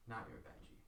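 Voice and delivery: very soft, near-whispered reply; hushed unmasked stranger. Acted. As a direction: answer barely above a breath. GM voices the unmasked man.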